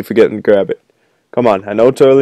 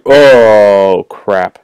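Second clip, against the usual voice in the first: ordinary talk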